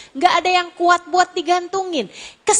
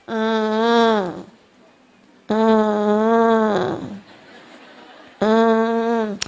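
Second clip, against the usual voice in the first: low monotone droning whimper; imitated lazy crying, one held hum; half-hearted child's whine; 5 semitones lower, much slower than usual. Preacher imitates a child crying without conviction, droning whine. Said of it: "ada anak nangis gini nih".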